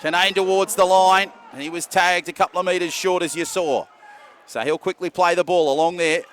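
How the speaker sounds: brisk, lively match call; live play-by-play. Real, not acted. Commentator calling the match live, brisk play-by-play. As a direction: keep the call moving with the play.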